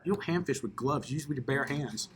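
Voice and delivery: ordinary talk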